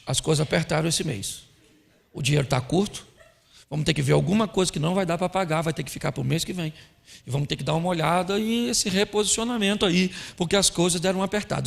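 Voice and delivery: low, soft, matter-of-fact husband; man discussing a problem. Acted. Husband telling wife money is short, low-key and serious. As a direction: confide quietly, in a subdued low voice. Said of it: "Homem vai falar de um problema".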